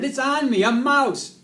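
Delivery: very loud, high startled yell; panicked shout. A narrator's reading. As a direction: shout out in sudden alarm. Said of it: "Marty shouted".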